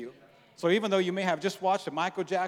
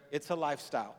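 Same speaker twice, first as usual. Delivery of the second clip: normal talking voice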